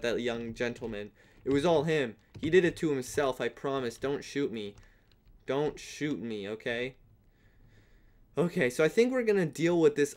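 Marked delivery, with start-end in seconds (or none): 3.4-7.0 soft begging. Pretend. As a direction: beg softly, drawing it out. Begging the shooter again.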